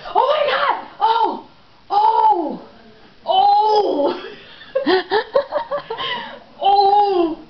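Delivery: loud, high-pitched cries of horror; mock-horrified shouting; shocked outcry. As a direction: yell as if watching a disaster unfold. Acts out Doug seeing squirrel jump.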